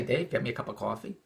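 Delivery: mild request. Retold or imitated. Voicing an unspoken polite request.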